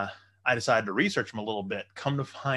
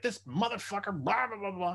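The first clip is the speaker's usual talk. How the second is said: mock pissed off; 9 semitones higher, 4 dB quieter than usual. Broker describing plans gone sideways, mock pissed off. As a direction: grumble it as a mild imitation of being fed up. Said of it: "you get all pissed off".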